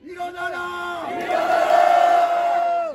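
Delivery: fading then rising final cry; roaring rallying speech. Real, not acted. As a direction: call out to the church. Closing rally cries. (외치는 집회 연설)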